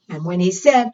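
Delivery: ordinary delivery, nothing marked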